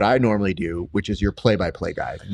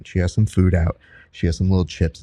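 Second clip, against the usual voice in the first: ordinary delivery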